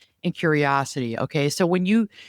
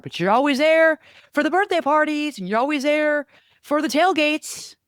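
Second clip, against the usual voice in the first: mock accusing rant; 10 semitones higher than usual. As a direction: build a bitter rant. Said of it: "don't say these things".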